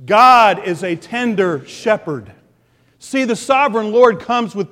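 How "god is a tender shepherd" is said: "God is a tender shepherd" said slowly, word by word, God stressed; slow call-and-response. Shown as a demonstration. Preacher leads the church repeating it together, slow. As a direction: lead the congregation through it slowly. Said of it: "Say it with me".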